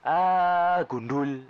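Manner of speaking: sharp high Betawi "ah, gundul"; loud high Betawi outburst; Betawi exclamation. Retold or imitated. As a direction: toss it off like a blunt Betawi local. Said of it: "Bahasa Betawi itu benar-benar kayak ciri khasnya".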